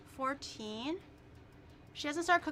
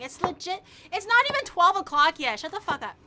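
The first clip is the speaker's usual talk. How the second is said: loud, higher outraged protest; indignant protest; 6 semitones higher, 11 dB louder than usual. Real, not acted. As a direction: protest loudly, playfully offended. Protests chat's four-hours claim.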